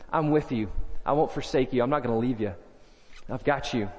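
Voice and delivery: hushed tender voice of Jesus; tender reassurance. Pretend. Jesus voiced tenderly over music.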